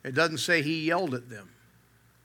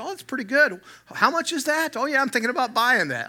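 high, hammed-up fake-curious shopper voice; mock interested shopper; 11 semitones higher, 3 dB louder than usual. Shopper faking interest at the sample table, high mock voice. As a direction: pretend you might buy it, pitched way up. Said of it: "You have to say".

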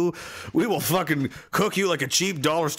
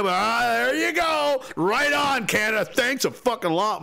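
high, drawn-out sarcastic thanks; sarcastic mock outrage; 7 semitones higher than usual. Thanking Canada for letting soldiers in, sarcastic outrage. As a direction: cheer bitterly in a raised, high voice.

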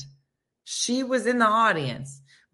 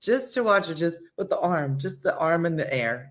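ordinary delivery

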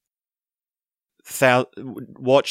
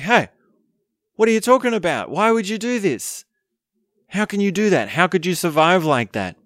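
much higher, outraged disbelief; stunned group protesting why he'd choose that; incredulous crowd; 8 semitones higher than usual. Retold or imitated. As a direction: voice the whole room being shocked at him. Everyone reacts in disbelief.

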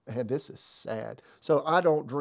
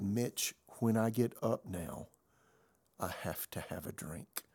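soft, fading retold confession, dropping very low and much softer at the end; broken confession; 4 semitones lower, 5 dB quieter than usual. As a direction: let the line sink into a defeated, hushed admission. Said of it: "I'll never forget the tone of his voice".

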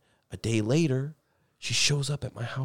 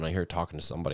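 ordinary talk, nothing marked

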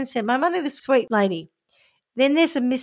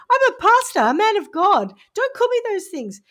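higher, louder mock outrage; mock indignant pastor; 10 semitones higher, 5 dB louder than usual. Scammer insisting to the victim he is a pastor, mock indignant. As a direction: squeak out offended innocence.

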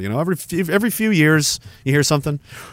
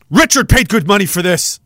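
usual speaking voice

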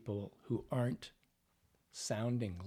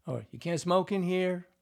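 higher and louder, annoyed; upset line; 7 semitones higher, 7 dB louder than usual. Shown as a demonstration. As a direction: push it out upset. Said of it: "like you're upset about saying this".

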